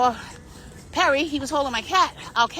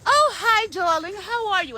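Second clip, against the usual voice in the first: put-on sugary greeting, pitched way up; fake-sweet killer greeting; 8 semitones higher than usual. Murder Maggie greeting someone before the kill, high fake-sweet gush. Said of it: "Murder Maggie is the type that will".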